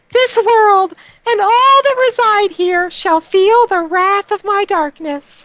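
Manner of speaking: very high, strange villain voice; booming echoing villain monologue. Shown as a demonstration. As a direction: shriek it like a cartoon overlord. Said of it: "the voice I gave was more".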